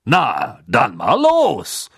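slow, delighted send-off; Bagman voice. A narrator's reading. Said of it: "sagte Bergmann und strahlte".

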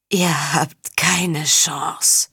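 very slow, cracking weeping voice; dying count, voice breaking as he weeps; broken voice. Acted. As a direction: force the words out through tears. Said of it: "mit brüchiger Stimme".

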